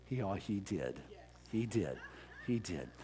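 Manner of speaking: quietly moved, lowering the voice; tender widow. Retold or imitated. Widow's famous tender reply.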